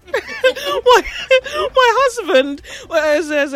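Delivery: laughing wife. Retold or imitated. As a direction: squeak it out mid-laugh like a giddy wife. Giggling imitation of the wife.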